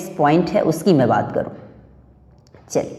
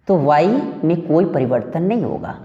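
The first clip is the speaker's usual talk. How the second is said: ordinary talk, nothing marked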